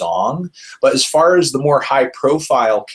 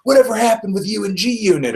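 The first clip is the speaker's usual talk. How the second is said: high, far-off put-on voice; sing-song boring interviewer; mocking interviewer voice; 10 semitones higher than usual. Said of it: "this really boring setup going on".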